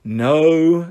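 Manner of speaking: firm, drawn-out "no"; exasperated mother's mild snap; loud snap. Acted. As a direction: snap it at the children, fairly loud. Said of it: "snapped Mother loudly".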